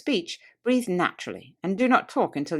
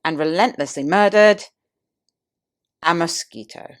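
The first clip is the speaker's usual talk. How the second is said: louder, pompous build then a long pause before "a mosquito"; mock grandiose climax; 6 dB louder than usual. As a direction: ham up "and relentlessly murdered", hold, then drop "a mosquito". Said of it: "proceeded in grandiose manner to a thrilling climax".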